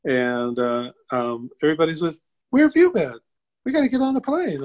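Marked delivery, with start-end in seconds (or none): 2.5-4.6 high-pitched, loud imitation of a frantic group; frantic scolding. Travel group finding the missing artist before the flight, mock frantic. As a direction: pipe up in mock alarm, urgent.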